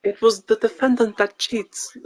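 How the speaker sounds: high, slow mock Russian voice; fake Russian accent. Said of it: "no more fake Russian voice".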